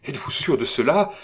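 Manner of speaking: anxious, hurried exclamation; breathless cry. A narrator's reading. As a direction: cry it out in a rush. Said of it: "s'écria Lily qui haletait".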